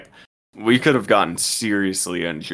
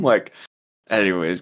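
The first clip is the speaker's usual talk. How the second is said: ordinary talk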